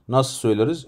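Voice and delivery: ordinary talk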